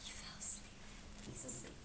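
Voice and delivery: put-on reading to a child, whispering that he fell asleep. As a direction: whisper so the sleeper won't wake. Story moment, Max falls asleep.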